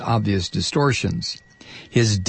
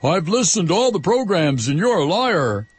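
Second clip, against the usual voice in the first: imitating the letter writer; 7 semitones higher than usual. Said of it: "the woman who wrote to me said".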